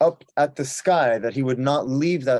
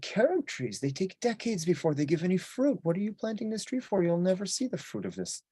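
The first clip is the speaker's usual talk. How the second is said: character voice; 7 semitones higher, 5 dB quieter than usual. Acted. Choni questions old man.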